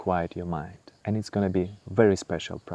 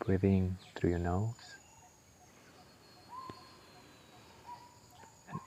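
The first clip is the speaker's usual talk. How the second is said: guided meditation; 5 dB quieter than usual. Acted. Guiding the listener through breath and body scan, calm meditation voice. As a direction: ease the listener inward, unhurried.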